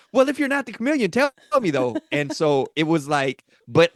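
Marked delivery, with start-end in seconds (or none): 0.1-2.0 very high, pressing challenge; pressing the newlywed to confess, in a very high, demanding voice; mock demanding. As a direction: push her for an answer in a squeaky voice. Pressing her in the game.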